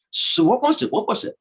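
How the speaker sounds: put-on high, impatient girlish voice; excited high-pitched questioning; eager gopis. Gopis pressing Lalita for the secret.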